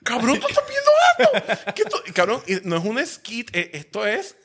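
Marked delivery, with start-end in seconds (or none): 0.0-2.0 high, laughing, incredulous squeal; retelling being stunned while watching a friend's video, with a high laughing squeal; shocked disbelief. Retold or imitated. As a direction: ask your friend if he's seeing this, laughing in shock. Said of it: "Y yo estoy viendo eso y yo".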